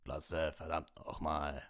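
lightly voiced Hagrid, slowing down; a drawn-out, mildly rough cheer as Hagrid; Hagrid calling out. A narrator's reading. Hagrid calling over the crowd, understated slow cheer. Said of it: "rief Hagrid mit strahlendem Gesicht".

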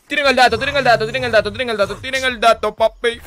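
insistent streamer hype; hyped repetition. Real, not acted. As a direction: hype up the viewers, pitched high. Hyping up the audience.

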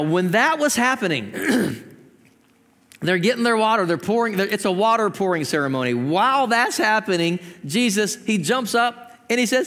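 ordinary talk

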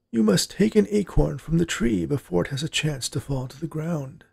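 put-on high-pitched old man recital; grandpa reciting. A narrator's reading. Grandpa recites the dryad rite to his granddaughter, reciting. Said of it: "he recited".

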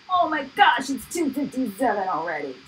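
sudden high gasp of surprise; shocked at the clock; surprised. Real, not acted. Notices the late time.

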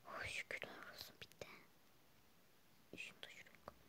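a soft whispered sigh of relief; whispered aside. Acted. Student whispering to herself after sitting down in class, soft relieved whisper. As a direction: keep it secret from the teacher.

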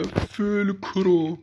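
high calling voice echoing; cave echo. Real, not acted. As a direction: call the name into an echoing room. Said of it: "odada ses yankı yapıyor".

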